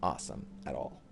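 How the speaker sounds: low, soft, dejected; real letdown. Real, not acted. Streamer let down by his exotic drop, deflated. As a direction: let it sink, quiet and deflated.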